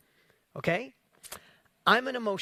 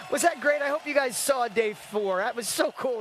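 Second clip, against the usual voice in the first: usual speaking voice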